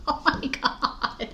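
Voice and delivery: breathy laughing oh my God. Real, not acted. Cracking up at her own blooper. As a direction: laugh it out slowly.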